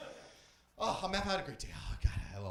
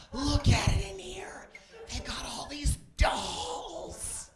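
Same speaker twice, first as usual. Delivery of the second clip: mock wonder; 14 semitones higher, much slower than usual. Real, not acted. Mock awe at dolls.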